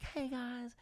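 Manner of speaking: breezy friendly hi to the neighbours; sweet high greeting. Retold or imitated. Meg greeting the neighbours, sugary high and soft. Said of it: "she's like".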